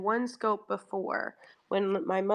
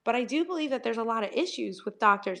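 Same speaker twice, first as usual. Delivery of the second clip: ordinary talk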